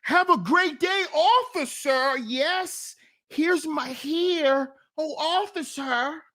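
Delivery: high, drawn-out eager-to-please voice; mock a man anxiously obliging an officer; high-pitched, hammed-up submissive politeness; over-eager deference to police.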